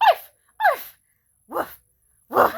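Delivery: high yaps, then shorter woofs; imitated dog barks. Mimicking a grown man made to bark, high yapping. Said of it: "grown man to just be like".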